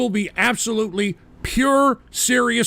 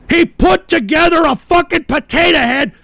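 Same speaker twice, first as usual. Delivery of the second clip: loud, higher furious rant; angry rant; 6 semitones higher, 5 dB louder than usual. Real, not acted. Ranting at chat about a potato head, loud and furious. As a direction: yell it in disbelief.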